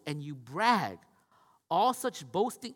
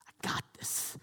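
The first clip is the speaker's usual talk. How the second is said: whispered gloat; 7 dB quieter, slower than usual. Retold or imitated. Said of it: "He's like".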